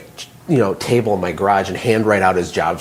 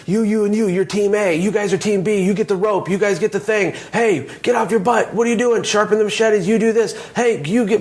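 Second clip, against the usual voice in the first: barked orders, much higher pitch; bossy manager; 10 semitones higher than usual. Retold or imitated. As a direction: rattle off commands like a frantic foreman on the ground. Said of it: "And, and he says".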